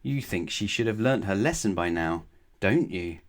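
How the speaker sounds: ordinary delivery, nothing marked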